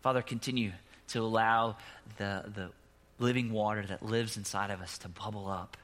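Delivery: soft, low closing prayer; hushed pastoral prayer. Acted. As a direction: pray gently over the congregation.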